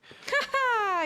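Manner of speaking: high burst of laughter; bright laugh at the joke; real laugh. Real, not acted. Laughing at his boast.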